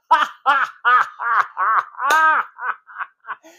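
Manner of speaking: a high, loud laugh at someone's expense; mocking laugh. Locals laughing at the Yankee salesman, high and loud.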